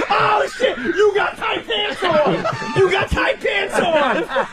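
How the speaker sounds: high, slow shouted taunt; mocking shout. Heckler taunting about tight pants, high and slow. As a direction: holler the taunt, drawn out. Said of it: "they walk around".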